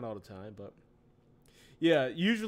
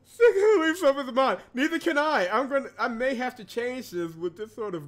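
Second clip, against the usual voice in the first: giddy; 10 semitones higher, 11 dB louder than usual. Real, not acted. Excited after laughing.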